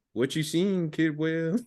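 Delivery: lightly voiced, half-spooked question; puzzled specialist. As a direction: comrade asking, a little unsettled. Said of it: "He's like".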